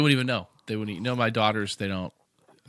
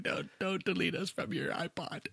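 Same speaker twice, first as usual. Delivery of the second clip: high, softer fake begging; mock pleading; 8 semitones higher, 6 dB quieter than usual. Co-host clinging to departing listeners, mock pleading in a high voice. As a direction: plead pitifully, hammed up.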